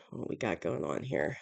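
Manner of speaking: normal talking voice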